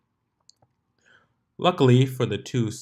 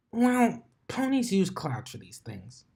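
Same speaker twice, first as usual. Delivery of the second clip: Dash voice; 10 semitones higher than usual. Acted. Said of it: "she said".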